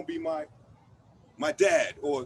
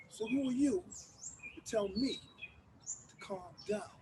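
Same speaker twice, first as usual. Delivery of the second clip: menacing whisper; 10 dB quieter than usual. Acted. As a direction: drop to a hiss of a question. Before final outburst.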